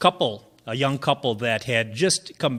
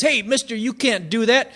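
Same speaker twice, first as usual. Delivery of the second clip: calling out; 8 semitones higher, 4 dB louder than usual. Acted. Bag boy yells at strangler.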